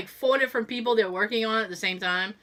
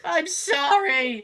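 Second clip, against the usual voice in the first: mock sobbing apology; 6 semitones higher, much slower than usual. Airplane bit apology.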